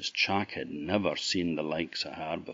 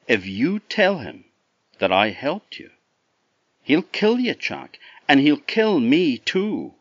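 urgent warning; 8 semitones higher, 9 dB louder than usual. A narrator's reading. Girl warning Jack urgently.

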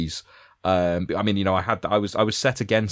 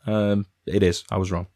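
ordinary talk, nothing marked

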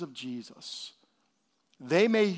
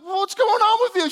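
high, loud, startled question; surprised; 14 semitones higher, 8 dB louder than usual. Retold or imitated. Pastor replays his own surprise at his wife, high startled voice. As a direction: ask in mock astonishment, pitched way up. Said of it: "So I'm like".

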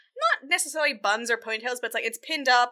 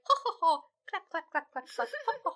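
high, soft fake laughter and clapping; tinny little courtly titter, much higher and quieter; polite titter; 7 semitones higher, 5 dB quieter than usual. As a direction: mime a courtier's dainty applause. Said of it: "Very polite".

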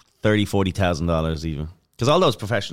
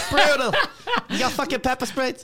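Bill Burr. Retold or imitated. Impersonating Bill Burr reaction.

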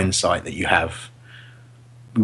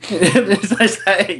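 teasing disbelief; 9 semitones higher, much slower than usual. Real, not acted. Teasing friend's short answer.

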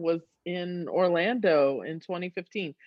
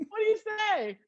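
high nervous; 13 semitones higher, much faster than usual. Retold or imitated.

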